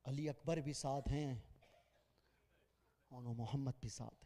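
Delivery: hushed majlis lament; mournful recital. Acted. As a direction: intone the grief quietly, drawing out each name. Soft lament opening the majlis.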